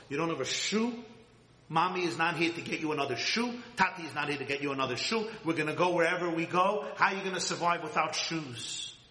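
big sister scolding her little brother, a touch of anger. Retold or imitated. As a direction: play a teen sister nagging her brother. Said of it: "I screamed at my little boy".

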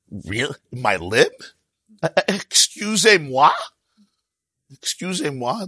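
high, affronted excusez-moi with a rising tune; mock offended protest; mock indignation. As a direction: play the outraged modern listener, hammed up. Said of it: "The ultimate abuse".

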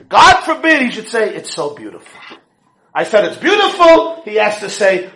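loud, high mock outrage at her father; building sarcastic inner rant; exasperated rant. Daughter fuming inside at her contrary father, loud and mocking. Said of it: "And she thinks".

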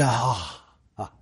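long, soft sigh of relief; relieved sigh. Acted. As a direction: let out a breathy "ah" as tension drops. Relaxed amygdala sighs in relief.

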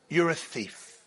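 soft, hushed accusation; quiet accusing voice. Acted. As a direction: hush it like a whisper inside your head. Inner voice, whispered aside.

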